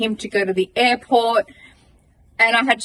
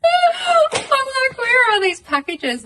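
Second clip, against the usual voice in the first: 1 frantic laughing; slower than usual. Real, not acted. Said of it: "And I'm frantic".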